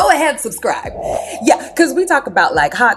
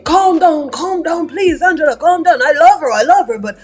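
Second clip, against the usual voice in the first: pleading Michael; 6 semitones higher than usual. Retold or imitated. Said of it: "Michael's like".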